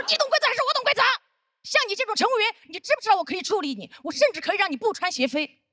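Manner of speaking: imitating a high, loud, bossy tirade; angry berating. Senior colleague threatening a crew member over shoes, angry berating.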